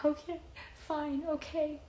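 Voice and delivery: high-pitched reluctant okay; grudging agreement. Retold or imitated. Patient giving in to the nurse, mock grudging.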